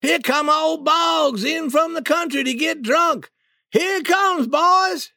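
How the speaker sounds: a townsman hollering that Boggs is coming; shouted. Acted. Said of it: "someone shouted".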